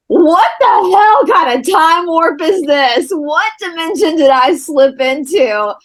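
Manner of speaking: high, slow, astonished disbelief; incredulous. Retold or imitated. Retells her bewildered reaction on arrival.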